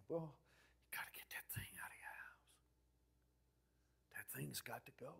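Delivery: hushed, whispered warning; urgent whisper. Retold or imitated. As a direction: whisper it as confidential counsel. Whispered reply to parishioner.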